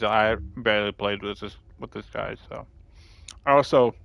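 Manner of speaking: talking with a mouth full of bread. Real, not acted. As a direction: chew while speaking. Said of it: "sorry I'm talking with my mouth full".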